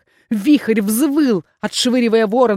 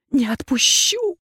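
strained hoarse "Не отпущу!", higher; "Не отпущу!" croaked, stressed on не; hoarse wheeze; 5 semitones higher, slower than usual. A narrator's reading. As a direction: rasp out the refusal through exhaustion. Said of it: "хрипела я".

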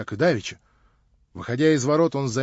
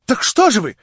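всполошившись; 6 semitones higher, 5 dB louder than usual. Acted. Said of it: "всполохнулся Липутин".

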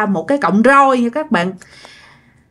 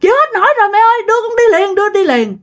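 much higher, fast, hammed-up crying plea; overacted pain; 11 semitones higher, faster than usual. Mother overacting labor pain to rush grandma, high and fast. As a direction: wail that you can't take it anymore, overdoing it. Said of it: "do mẹ làm quá".

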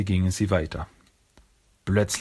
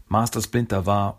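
usual speaking voice

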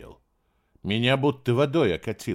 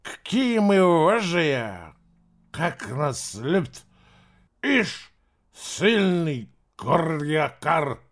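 higher, slow mocking drunk slur; nasty drunk; 6 semitones higher, much slower than usual. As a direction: taunt him, slurring and sneering. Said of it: "злобной ухмылкой".

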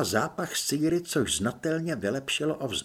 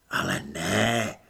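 a hint of a low, slow stranger; lightly voiced unseen helper, reassuring; hidden adviser; 7 semitones lower, slower than usual. Voice behind wall reassuring Apoléna, slow and lightly low.

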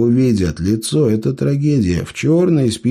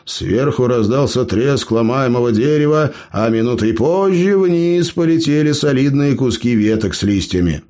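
ordinary delivery, nothing marked

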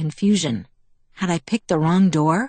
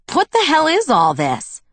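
high-pitched, startled outburst; spluttering; 11 semitones higher, 4 dB louder than usual. A narrator's reading. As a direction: splutter it in shocked disbelief. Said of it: "I spluttered".

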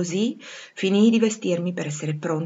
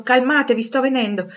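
raised, higher voice to settle people; calling back; 7 semitones higher, 7 dB louder than usual. A narrator's reading. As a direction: call reassurance across the gallery. Rochester calls back to guests. (richiamo ad alta voce)

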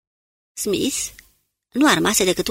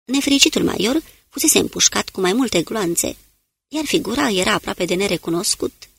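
ordinary delivery

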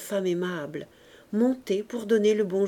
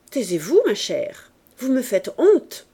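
louder, sharp rebuke; annoyed; 3 semitones higher, 6 dB louder than usual. A narrator's reading. Mother scolding the sobbing widow, annoyed. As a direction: cut her off in displeasure. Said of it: "l'air fâché".

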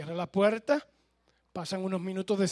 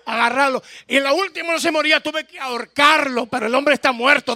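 relato agitado; 5 semitones higher, 8 dB louder than usual. Acted. Mujer cuenta la pelea agitada.

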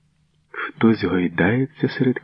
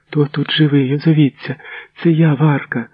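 high, raised voice searching in the dark; calling out; 9 semitones higher, 3 dB louder than usual. A narrator's reading. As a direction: call out for any survivor. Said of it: "долітає голос з темряви". (високий голос, гукає в темряву)

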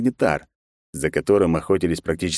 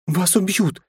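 higher, louder urgent shout; raised voice; 12 semitones higher, 4 dB louder than usual. Acted. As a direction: raise the voice in alarm. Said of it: "Лариса повысила голос".

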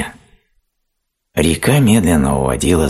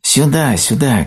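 high, eager little calls; high-pitched, repeated storybook cries; many voices calling; 12 semitones higher, 4 dB louder than usual. A narrator's reading. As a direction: beckon from all sides in tiny voices. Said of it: "кричали со всех сторон".

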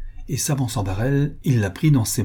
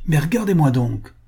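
higher-pitched indignant command; narrator's acted flash of anger; furious; 6 semitones higher, 3 dB louder than usual. A narrator's reading. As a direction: snap it in contained fury. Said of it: "d'une voix que la fureur faisait trembler".

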